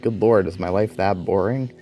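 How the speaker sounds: ordinary delivery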